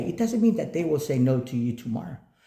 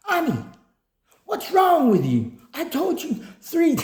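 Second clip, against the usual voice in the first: retold boss yelling; 11 semitones higher than usual. Boss yelling at Ami for coming back again, mock shout. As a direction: shout at her like an exasperated boss. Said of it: "he was screaming and shouting".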